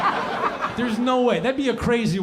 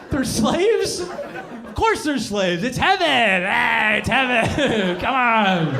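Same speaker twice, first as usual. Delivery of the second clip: laughing it off; 6 semitones higher, slower than usual. Retold or imitated. Jesus laughing off slaves.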